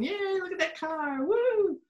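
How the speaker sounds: cheering crowd. Retold or imitated. Teacher voicing townsfolk cheering the car, excited. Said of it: "Everybody's going".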